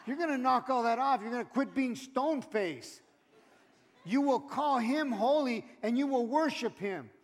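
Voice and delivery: loud, rising preacher's call; fired-up pulpit preaching; preaching at full force. Acted. Preaching to the congregation.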